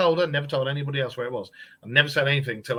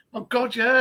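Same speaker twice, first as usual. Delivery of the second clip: ordinary talk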